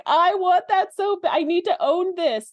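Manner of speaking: high, slow, swooning longing; dreamy covetous gush; gushing want. Retold or imitated. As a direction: coo it like you're aching to own it. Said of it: "And I was like".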